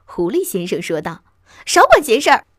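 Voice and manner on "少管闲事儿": high, loud snap; shrieking. A narrator's reading. Mouse answers the fox's accusation, shrieking. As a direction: squeal it back at the fox. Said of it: "老鼠尖叫道".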